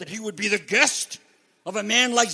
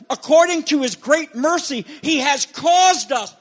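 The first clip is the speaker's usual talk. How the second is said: preaching shout; 6 semitones higher, 6 dB louder than usual. Acted. Preacher's climax of the verse.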